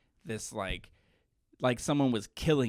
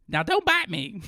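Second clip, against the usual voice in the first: higher, louder mock scolding; imitated grandmother scolding; 7 semitones higher, 5 dB louder than usual. Grandmother to the kid who bites her, mock scolding. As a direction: scold a child in a raised grandma voice. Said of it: "the grandmother's just like".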